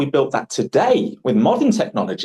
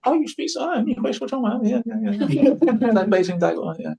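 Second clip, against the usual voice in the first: high-pitched, softer voice imitating an impressed new acquaintance; put-on high voice of an amazed listener; excited Chinese acquaintance; 8 semitones higher, 3 dB quieter than usual. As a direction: mimic someone marvelling in quick little reactions. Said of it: "whenever I meet a Chinese person".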